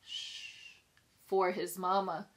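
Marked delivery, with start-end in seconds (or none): 0.0-0.8 whispered shush, no voice at all; gentle whispered shh; soft shush. Acted. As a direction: breathe out a hush like calming a baby. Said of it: "whispers softly".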